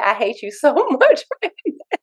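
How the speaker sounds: playful mock hatred, laughing through it; silly mock complaint. Student teasing the coach for pushing him to cry, silly laughing. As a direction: tease back with a laugh. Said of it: "in a silly way".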